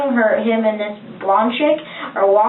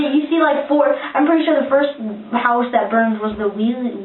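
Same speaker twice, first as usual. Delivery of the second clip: normal talking voice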